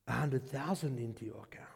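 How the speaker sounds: whispered, low and soft; retold whisper of big news; hushed secret. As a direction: breathe it like a secret. Husband's hushed news of the gift.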